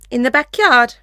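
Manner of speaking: yelling across the room. Acted. Mom calls out, exasperated.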